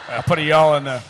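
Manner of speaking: loud laugh through the words; cracking up at his own Southern slip. Real, not acted. Joking about his accents.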